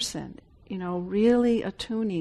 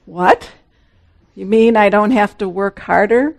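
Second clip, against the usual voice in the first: surprised; 2 semitones higher, 12 dB louder than usual. Pretend. Mock surprised listener.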